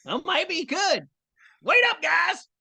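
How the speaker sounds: put-on high-pitched character voice with a Texas accent; Usopp. Voice actor testing the character in Texan, high and shouty. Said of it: "Usopp could have a Texas accent".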